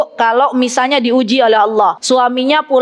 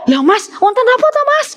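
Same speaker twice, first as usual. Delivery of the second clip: much higher prying question in Javanese; nosy wife pestering her shivering husband, much higher; 10 semitones higher than usual. Retold or imitated.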